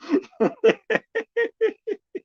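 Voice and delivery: high-pitched burst of real laughter. Real, not acted. To his viewers after his porn joke, high real laugh. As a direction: crack up at your own joke.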